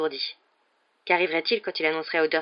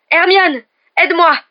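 high, loud cry for help; urgent full-voiced appeal; desperate shout; 12 semitones higher, 14 dB louder than usual. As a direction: yell to a companion for rescue. Harry calls desperately for help.

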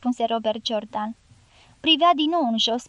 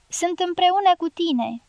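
higher call across the distance; a moderate narrated shout; shouting back; 6 semitones higher than usual. A narrator's reading. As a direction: call after her as she rides away. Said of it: "strigă Robert Jordan".

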